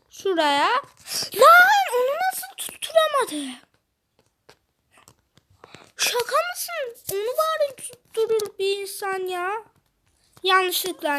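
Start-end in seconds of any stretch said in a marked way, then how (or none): 1.4-9.5 incredulous shout. Real, not acted. Gamer shouting at a player who missed the jump, incredulous cry. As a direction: yell in disbelief at a teammate's miss.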